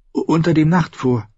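high, faltering Sam; nervous stutter. Acted. Sam names the castle to the king, stuttering slightly. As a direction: catch slightly on the first vowel. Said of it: "stotterte Sam".

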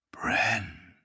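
soft, far-off murmur from the tree. A narrator's reading. Tree murmuring the boy's name, soft. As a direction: breathe the name like rustling wood. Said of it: "murmelte der Baum".

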